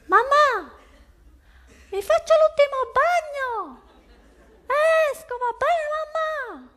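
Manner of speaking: put-on child pleading with mother for one last swim; whiny child. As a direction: beg like a kid who won't leave the sea. Kid pleading from the water.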